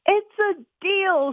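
high, slow, eager shout. Acted. Boy shouts agreement to his sister while running outside. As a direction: fire it off, already heading out the door. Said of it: "shouted Mike".

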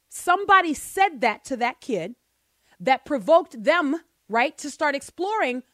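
loud, high, fervent preaching. Acted. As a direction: preach it like a sermon. Preaching on parenting at full force.

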